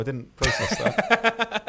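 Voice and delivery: high, slow, laughing through the words; cracking up at his own blunder; laughing disbelief. Real, not acted. Player who smoked himself, laughing in disbelief.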